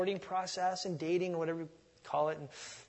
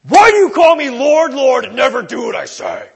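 mock angry, high and much louder shout; ticked-off Jesus; 8 semitones higher, 23 dB louder than usual. Shown as a demonstration. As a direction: bark it like a ticked-off boss. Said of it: "with a ticked off tone".

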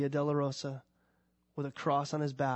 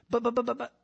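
higher, stammering protest; protesting believer; 7 semitones higher, faster than usual. Pretend. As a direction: sputter objections. Voicing doubting believer.

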